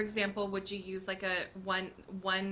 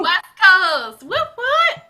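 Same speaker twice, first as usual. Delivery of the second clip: very loud, high whoop; excited cheer; 10 semitones higher, 19 dB louder, much slower than usual. Real, not acted. Teammate answering with a West Coast shout out, loud excited whooping. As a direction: holler proudly for your side.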